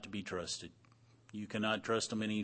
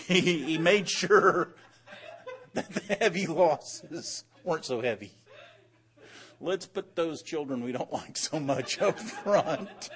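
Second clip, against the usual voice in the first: higher, louder, words breaking into laughter; laughing while talking; 6 semitones higher, 6 dB louder than usual. Real, not acted. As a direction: struggle to get the joke out through real laughter. Cracking up over Jacob's callous plan.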